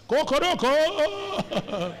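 slow, high crowing cockerel; mock cock-a-doodle-doo; rooster crow. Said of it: "the cock was like".

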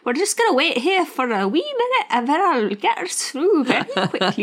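high, lilting Scottish bus driver impression; Scottish accent. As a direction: play a chirpy Scottish coach driver, veering into Irish. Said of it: "He was Scottish".

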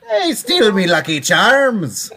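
high, loud, slow stage-Irish; cartoon Irish brogue; mock Irish accent. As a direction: ham up a leprechaun Irish accent. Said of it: "every Irish accent".

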